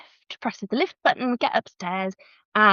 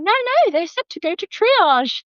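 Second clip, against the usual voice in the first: high panicked protest; 11 semitones higher than usual. Retold or imitated. Protesting in labour.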